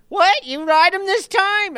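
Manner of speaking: the chief can't believe it, loud and high; startled high-pitched chief. Retold or imitated. Chief reacts to the jockey's demand, loud high incredulity. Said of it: "he had that kinda high-pitched voice".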